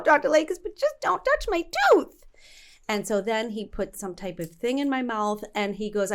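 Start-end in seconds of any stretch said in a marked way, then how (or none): 0.0-2.2 much higher, louder, whiny plea; high pleading. Retold or imitated. Patient begging Doctor Lakis not to touch, high whine. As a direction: squeal it like a scared patient.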